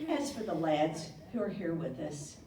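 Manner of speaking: ordinary talk, nothing marked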